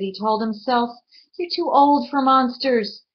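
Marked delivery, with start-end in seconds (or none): 1.4-3.1 louder, higher scolding; stern self-scolding. Acted. Connor telling himself off about monsters, louder. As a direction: snap at yourself to be brave.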